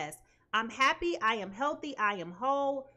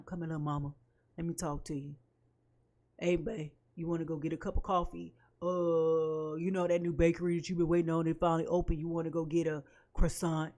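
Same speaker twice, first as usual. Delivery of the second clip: lower, slick coaxing voice; low, smooth sweet-talker, faster on the bakery lines; smooth-talking suitor; 10 semitones lower than usual. Retold or imitated. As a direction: sweet-talk her like a charming ex. Smooth suitor coaxing her back.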